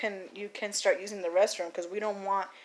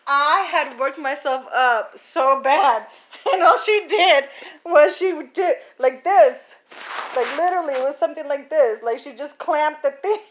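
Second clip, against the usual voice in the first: loud, higher voice broken by real laughter; real giggles, voice raised in amusement; laughing incredulously; 5 semitones higher, 9 dB louder than usual. Real, not acted. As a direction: tell it while cracking up. Laughing over how worked up she got.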